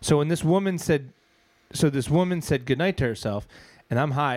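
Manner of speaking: ordinary talk, nothing marked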